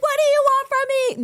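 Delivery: very high, loud scream upward; screamed at the sky. Retold or imitated. From the balcony to the sky, very high loud scream. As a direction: yell it up into the night sky. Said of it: "I screamed randomly at the sky".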